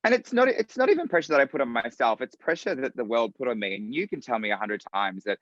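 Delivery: normal talking voice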